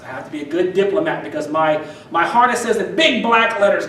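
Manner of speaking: ordinary talk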